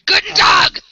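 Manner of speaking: loud, high mock-German bark; hammed-up German shout; angry German tone. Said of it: "in an angry German tone".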